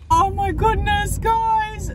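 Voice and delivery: high, bright-eyed oh my goodness; excited. Real, not acted. Vlogger greeting her viewers, excited. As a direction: burst in with big news.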